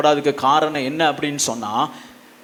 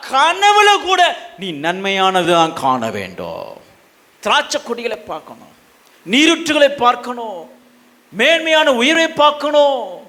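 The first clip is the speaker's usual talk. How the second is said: exhorting shout, rising higher with each phrase; impassioned preaching; 8 semitones higher, 5 dB louder than usual. Real, not acted. Preacher urging people to see good even in dreams, impassioned preaching. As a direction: drive the exhortation home to the crowd.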